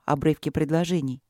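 plain reading voice

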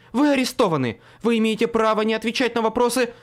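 громко и выше, быстро; чеканит права скороговоркой на крике; громкий приказ. Acted. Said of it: "рявкнула Мёрфи". (громкий быстрый приказ)

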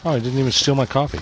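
lightly put-on mocking voice, fast; mocking the thief. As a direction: mimic the thief's smug excuse. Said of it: "you're like".

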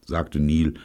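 ordinary delivery

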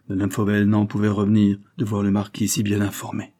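ordinary delivery, nothing marked

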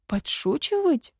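high, surprised echo; astonished question. A narrator's reading. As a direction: repeat it in amazement, pitch leaping up. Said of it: "изумилась Виссория".

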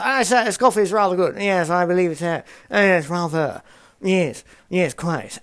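hammed-up upper-class British toff voice; haughty British accent. Son imitating posh British tea chat with his mum. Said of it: "we put on these really haughty British accents".

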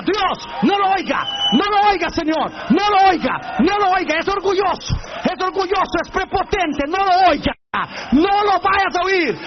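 high, insistent begging; acted urgent plea. Preacher begging God not to hear the proud man, urgent. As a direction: plead at the top of the range, repeating insistently.

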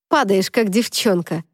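plain reading voice

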